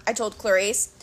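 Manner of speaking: ordinary delivery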